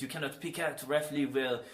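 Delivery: put-on French accent; mock French-accented English. Shown as a demonstration. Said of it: "somebody talking in the French accent".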